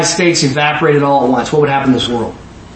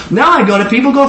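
ordinary talk, nothing marked

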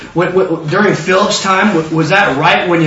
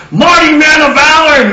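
high, loud proclamation; heralding call; 8 semitones higher, 6 dB louder than usual. Retold or imitated. Messenger hailing Gideon, grand loud greeting. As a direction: hail him like an announcing herald.

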